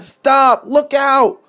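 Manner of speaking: high, urgent warning shout; alarmed cry. A narrator's reading. Driver warning as the sun kink nears, alarmed cry. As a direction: yell a warning at the top of the range. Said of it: "he cried".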